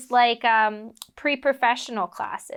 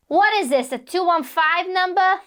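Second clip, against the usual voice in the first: higher, louder smoker agent impression; Edie Robb; 7 semitones higher, 4 dB louder than usual. Agent questioning a cold caller's number, smoker agent impression. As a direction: bark it like a pack-a-day agent. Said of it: "and she goes".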